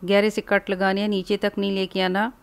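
ordinary talk, nothing marked

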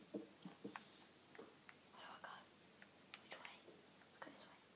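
hushed whisper, barely voiced; sneaky whisper. Real, not acted. Gamer creeping through a cave, whispering. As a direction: whisper like you might get caught.

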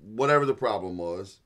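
usual speaking voice

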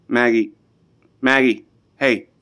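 usual speaking voice

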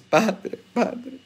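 mock sobbing plea to the priest; imitated weeping mothers. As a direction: imitate a mother crying for her son. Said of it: "Todos llorando por sus hijos".

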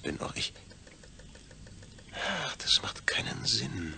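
slow, resigned whisper; hushed sigh of frustration. Acted. Man whispering that none of it makes sense. Giving up on the logic.

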